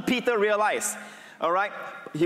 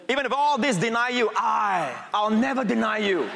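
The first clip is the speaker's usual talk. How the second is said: Peter's bold vow; 5 semitones higher than usual. Retold or imitated. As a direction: swear it like a proud disciple. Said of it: "Peter says".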